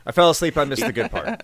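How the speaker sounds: loud mock sorry; sarcastic apology. Real, not acted. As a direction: fake an apology with heavy sarcasm.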